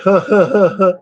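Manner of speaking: loud, high mock laugh; high hammed-up creepy laugh; imitated creepy laugh. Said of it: "he'll be like".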